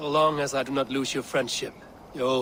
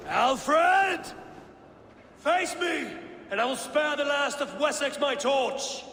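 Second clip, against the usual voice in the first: defiant challenge shouted across the burning village; challenging shout; 11 semitones higher, slower than usual. Acted. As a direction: challenge the king to face her. Calling out challenge to Alfred.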